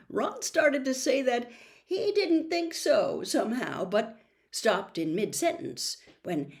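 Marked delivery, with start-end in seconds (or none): none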